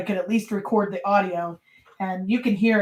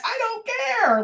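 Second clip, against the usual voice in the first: much higher cry of I don't care; tearful outburst; slower than usual. Retold or imitated. Wife pleading to move the chicken coop, high tearful outcry. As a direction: wail it out in a desperate retold fit of tears. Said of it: "broke down and crying".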